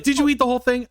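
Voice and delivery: high, loud mock alarm; acted-out alarmed questioning; panicked question. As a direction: imitate someone urgently demanding an answer. Alarmed question from the kitchen, retold.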